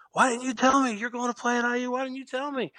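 high, excited pitch of a giddy coach; bubbling over, half accusing. Retold or imitated. High school coach bursting with news, giddy and high. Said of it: "he's just giddy".

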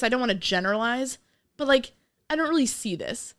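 ordinary talk

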